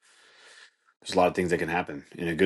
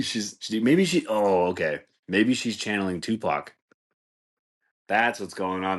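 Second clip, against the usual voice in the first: normal talking voice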